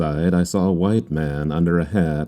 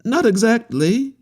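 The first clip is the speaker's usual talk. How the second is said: much higher voice, quick second thought; correcting himself. A narrator's reading. Harlequin correcting himself to the staring captain, high voice. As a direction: take it back with a shrug. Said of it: "he corrected himself".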